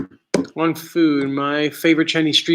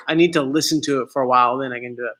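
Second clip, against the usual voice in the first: usual speaking voice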